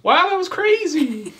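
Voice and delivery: very high, laughing gasp of amazement; amazed paramedic. Acted. Dazed paramedic reacts to the storm, high and laughing. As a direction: squeal it out in wide-eyed disbelief. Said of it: "paramedic guy ... goes".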